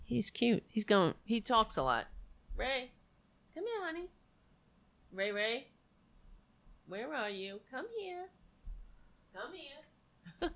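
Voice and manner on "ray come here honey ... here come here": much higher, much softer coaxing call; sing-song the cat's name up high; high pet call. Real, not acted. Coaxing her cat to come, high soft pet voice.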